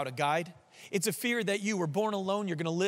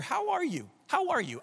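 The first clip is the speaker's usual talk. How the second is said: bright greeting; 8 semitones higher than usual. Retold or imitated. Mimics asking people a question.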